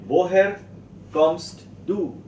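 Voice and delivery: teacher spacing out a new German question; word-by-word modelling. Shown as a demonstration.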